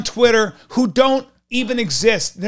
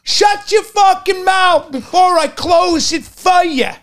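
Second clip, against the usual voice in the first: Brooklyn tough guy yell; 6 semitones higher, slower than usual. Retold or imitated. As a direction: bark it like a Brooklyn street heavy. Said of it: "we'd go".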